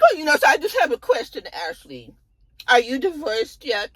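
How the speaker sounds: high, fast, fake-casual question; a high, quick, faux-innocent probe; nosy Karen.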